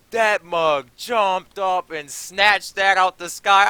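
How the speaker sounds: high, loud and slow with amazement; excited retelling. Real, not acted. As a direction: relive the jump, thrilled. Amazed retelling of dog jump.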